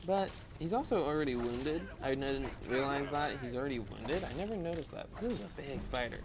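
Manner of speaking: ordinary talk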